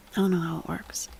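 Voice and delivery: sheepish whisper; whispered admission. Real, not acted. Streamer admits confusion to chat, whispering. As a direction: trail off into a whisper.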